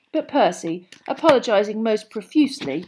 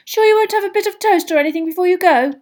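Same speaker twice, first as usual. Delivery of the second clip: motherly Mrs Weasley; 10 semitones higher, 9 dB louder, faster than usual. A narrator's reading.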